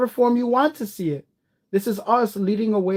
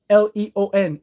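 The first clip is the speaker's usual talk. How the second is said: ordinary talk, nothing marked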